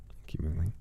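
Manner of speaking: soft whispered thanks; whispered thanks to a viewer. Real, not acted. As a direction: breathe the thank-you. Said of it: "whispered thanks".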